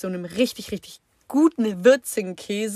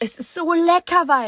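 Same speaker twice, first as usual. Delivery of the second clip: enthusiastic; 8 semitones higher, 5 dB louder than usual. Real, not acted. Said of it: "raving about bread".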